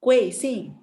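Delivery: "Kuing Sing" loud, both syllables falling steeply; angry falling fourth tone. Shown as a demonstration. Teacher corrects a too gentle pupil, "Kuing Sing" falling angrily. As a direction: hit "Kuing Sing" crossly and let each drop.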